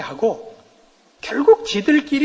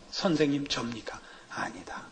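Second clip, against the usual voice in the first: hushed; 6 semitones lower, 10 dB quieter than usual. Retold or imitated. Disciples ask Jesus, retold joke.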